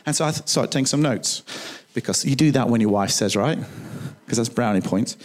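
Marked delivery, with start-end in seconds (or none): none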